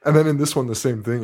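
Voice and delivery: usual speaking voice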